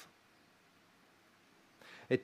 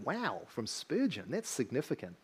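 much softer, awed aside; awed hush; 9 dB quieter than usual. Retold or imitated. Pastor imagining Spurgeon's praise, quietly awed. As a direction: drop to a hushed, impressed murmur.

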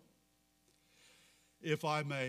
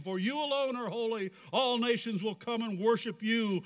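raised, projected preacher's reading; fervent scripture recitation; 5 semitones higher, 3 dB louder than usual. Acted. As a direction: declaim the scripture to a whole congregation. Preacher recites scripture at full force.

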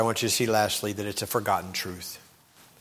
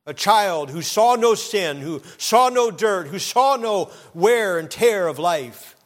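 louder, higher, slow preaching; preaching build; 8 semitones higher, 6 dB louder than usual. Acted. Preacher telling the church about the child, slow loud preaching. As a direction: proclaim each line with force. Preaching climax, repeated "who saw.

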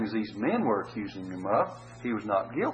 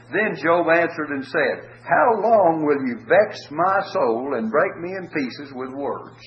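slow, raised pulpit reading of Job's words; solemn scripture reading; 3 semitones higher, 5 dB louder than usual. Acted. Preacher reading Job's words to the congregation, slow and solemn. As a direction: read it out as Job's lament to his comforters, slow and solemn.

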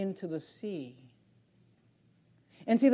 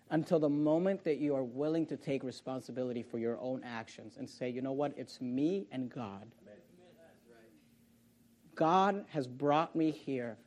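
hushed solemn preaching; 6 semitones lower, 8 dB quieter than usual. Real, not acted. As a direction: drop down low and let each phrase weigh. Hushed solemn close of sermon.

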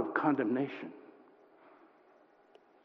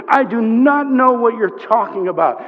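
mild anger, raised and emphatic; angry denial; 8 semitones higher, 9 dB louder than usual. Acted. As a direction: deny it forcefully to an accuser. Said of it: "His anger rises to the surface".

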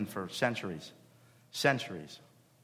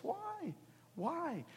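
high rhetorical why; 6 dB quieter than usual. Real, not acted. Preacher stressing rhetorical question.

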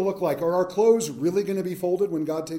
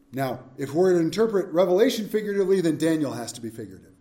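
usual speaking voice

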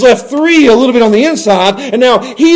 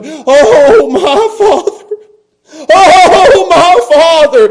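high, sobbing plea; anguished crying out; 10 semitones higher, 7 dB louder, much slower than usual. Acted. Jesus cries to the Father in agony, wailing. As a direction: wail it from face down in the dirt. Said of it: "he cries out with his face in the ground".

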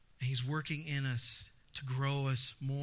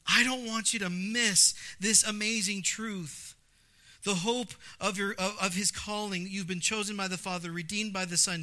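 louder preaching, slowed for emphasis; voicing Paul's plea; 4 semitones higher, 4 dB louder than usual. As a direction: lean into the plea as if in Paul's prayer.

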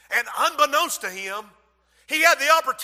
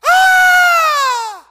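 piercing high shriek of horror; terrified scream; 10 dB louder than usual. Acted. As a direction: scream as death strikes. Scream in the night in Egypt.

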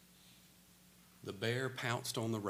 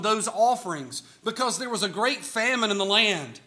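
louder, higher, driving sermon voice; full-force preaching; 8 semitones higher, 8 dB louder than usual. Acted. Preacher pressing the famine story on his congregation, full-force preaching. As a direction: preach it out to the whole church.

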